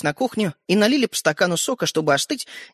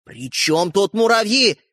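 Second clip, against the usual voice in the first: slow indignation climbing higher; building to shout; 7 semitones higher, 3 dB louder than usual. A narrator's reading. Brother accuses sister over toy, shouting. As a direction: build into a high, angry yell. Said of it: "перешёл на крик Родька".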